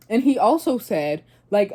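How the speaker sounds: ordinary delivery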